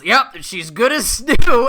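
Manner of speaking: high, loud, laughing goat voice; repair goat voice. Retold or imitated. Cohost voices the breezy repair goat, laughing and loud. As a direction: cheerfully ham up the repairman. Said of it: "He goes".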